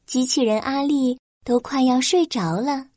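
ordinary delivery

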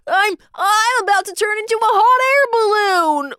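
plain reading voice

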